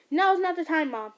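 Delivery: high, loud urgent shout; yelling. Acted. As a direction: yell it in a rush. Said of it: "he yelled out".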